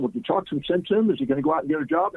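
a lightly voiced fretting mother, rising high and quick; anxious mother. Mother pressing the speaker for news, high and quick. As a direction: play the worried mom, pitch climbing and hurried. Mother asks if son is fixed.

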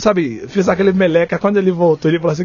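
ordinary talk

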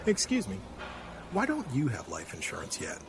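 ordinary talk

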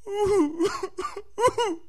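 high, hammed-up sobbing wail; put-on boo-hoo crying; mock wailing. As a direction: wail like the weeping Israelites. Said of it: "They cried. They wept".

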